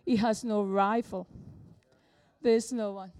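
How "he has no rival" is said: ordinary talk, nothing marked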